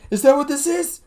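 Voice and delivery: high-pitched, loud mock demand; mock interrogation. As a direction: squeal the question in mock disbelief. Repeats own question, mock incredulous.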